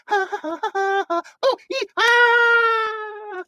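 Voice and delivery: very high squeals into a scream; high, loud mock scream; tumbling scream. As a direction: shriek as you tumble. Jill tumbles after.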